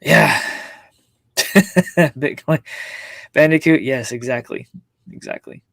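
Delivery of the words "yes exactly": ordinary delivery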